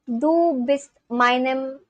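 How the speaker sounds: ordinary talk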